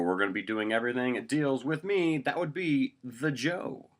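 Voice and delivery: high, loud show-opening announcer. Acted. Host welcomes viewers to first episode, announcer voice. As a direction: belt out a grand welcome, stretching the title. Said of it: "talks in this announcer voice".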